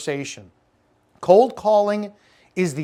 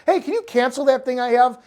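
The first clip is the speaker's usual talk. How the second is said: imitated customer; 8 semitones higher, 3 dB louder than usual. Voicing a customer cancelling.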